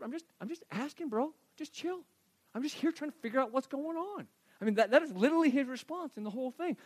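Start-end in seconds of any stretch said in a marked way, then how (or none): 0.0-4.3 high, soft, put-on whine of innocence; defensive protest. Younger brother answering the older one, defensive. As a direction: plead lightly in a raised voice.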